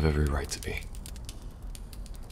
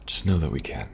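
usual speaking voice

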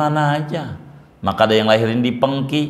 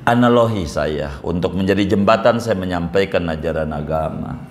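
usual speaking voice

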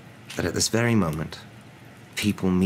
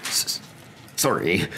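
stammered, sobbed apology; sobbing; much slower than usual. A narrator's reading. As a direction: choke out the words through sobs. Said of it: "sobbed Hagrid".

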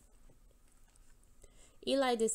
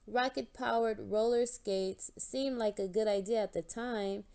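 plain reading voice

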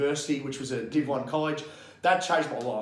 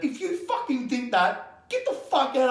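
fired-up telling-off; 8 semitones higher, slower than usual. Retold or imitated. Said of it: "You know what I say to them?".

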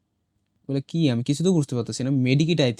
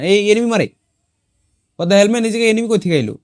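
higher, louder startled outburst; startled alarm; 7 semitones higher, 6 dB louder than usual. Real, not acted. Player suddenly shot at by an unseen enemy, startled alarm.

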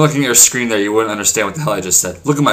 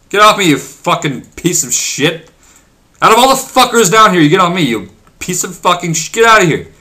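real irritated yelling; 5 semitones higher, 4 dB louder than usual. Real, not acted. Yelling at player blocking him.

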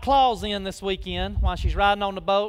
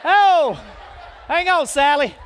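laughing through the words, louder; 6 semitones higher, 8 dB louder, slower than usual. Real, not acted. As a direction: crack up while calling to her. Laughing at the volunteer mix-up.

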